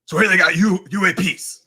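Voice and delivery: loud, high, badly faked character voice; Beetlejuice. Streamer trying a viewer's requested alien-disclosure impression, loud and badly faked. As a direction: attempt a bad impression at full volume. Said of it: "[name], can you do Beetlejuice".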